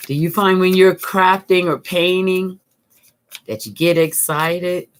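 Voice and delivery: ordinary talk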